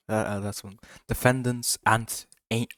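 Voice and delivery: normal talking voice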